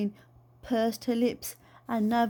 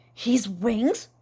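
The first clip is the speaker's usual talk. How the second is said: astonished. Acted. Aunt astonished.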